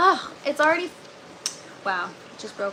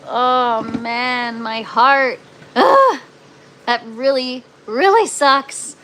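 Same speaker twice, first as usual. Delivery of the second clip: louder, drawn-out lament; loud dismay; 10 dB louder, much slower than usual. Real, not acted. Gardener mourning her broken tomato plant to viewers, loud lament. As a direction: moan over the lost plant, stretching really sucks.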